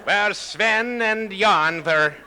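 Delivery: slow, high, loud mock Swedish joke voice; Swedish accent. Comic asked for a Swedish joke, in a mock Swedish accent. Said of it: "you want me to do an ethnic joke".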